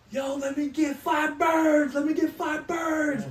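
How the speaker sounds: high, loud, stretched-out holler; hollered kitchen order. Retold or imitated. Cook calling an order to fry station, hollered. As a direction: bellow it across a busy kitchen.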